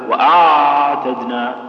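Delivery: "وأعتدنا" dragged slowly with the ع drawn out too long; ain overstretched, wrong. Shown as a demonstration. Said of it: "بالشكل هذا".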